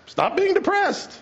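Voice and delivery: high, mocking "stop being depressed"; preacher mocking the advice for depression; mock command. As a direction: squeak it like a silly order.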